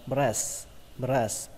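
"breast" ending on s, no t; dropped final T. Shown as a demonstration. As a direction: say "breast" as "bress", leaving off the t. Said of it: "các bạn bỏ âm T đi". (đọc "breast" bỏ âm t cuối)

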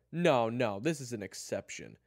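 loud, high insistent objection; heated refusal. Acted. Kyler refuses June's reminder of the rules, heated. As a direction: refuse forcefully. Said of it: "Kyler stated".

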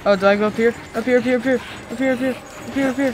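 loud, fast, frantic repeating. Real, not acted. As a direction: hurry and panic on every word. Fleeing, looking for escape.

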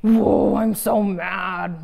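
hammed-up angry face voice; mock mad. Shown as a demonstration. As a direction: act grumpy for the kids. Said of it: "When I'm mad, I'm like".

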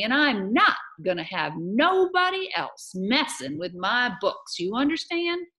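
loud, high scolding with punched words; indignant tirade. Acted. Amanda tells off Mars Bar about her books, indignant tirade. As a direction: rant at him, leaning on the key words.

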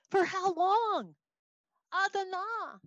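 high, slow, drawn-out plea; belted cry. Acted. Teacher acting the prophet protesting injustice, crying out. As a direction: wail it upward to God. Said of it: "I'm just gonna say belt it out".

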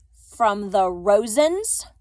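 slow, loud question rising at the end; sneering. A narrator's reading. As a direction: draw out the accusation with a sneer. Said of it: "continued with a sneer".

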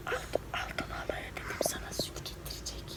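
whispered secret about the milk. Real, not acted. Mother whispering to her daughter about the aunt bringing milk. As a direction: breathe it like a secret.

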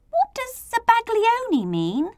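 high-pitched curious question; inquiring. A narrator's reading. Letter I puzzled by an odd word, high-pitched inquiry. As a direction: ask in a high little voice. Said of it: "I inquired".

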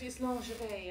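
lingerie purred the French way; French pronunciation of lingerie. Shown as a demonstration. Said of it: "that's nice, huh?".

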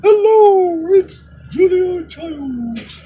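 very high, slow, lilting TV chef voice; Julia Childs impression. Host introduces himself as a TV chef, high fluttery impression. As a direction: greet the viewers like a fluttery TV cook. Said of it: "That's my Julia Childs impersonation".

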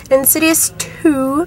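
ordinary talk, nothing marked

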